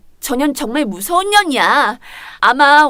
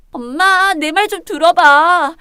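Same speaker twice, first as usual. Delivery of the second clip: ordinary delivery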